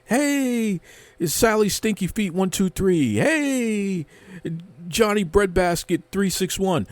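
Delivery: high, goofy caricature of fans; mocking online fans. Host mocking fans cheering each other online, goofy caricature. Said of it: "you high-five each other online".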